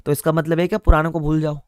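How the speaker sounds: normal talking voice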